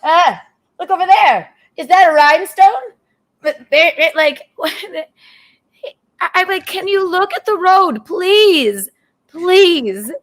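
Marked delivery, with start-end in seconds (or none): 0.0-2.9 higher-pitched mock distraction; distracted driver impression. Wife imitating husband at the wheel, lightly mock-distracted. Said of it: "it's like this". 6.7-10.1 higher, nervous mock plea; retold pleading. Wife begging husband to watch the road, retold pleading.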